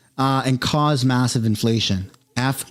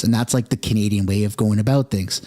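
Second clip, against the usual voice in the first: ordinary talk, nothing marked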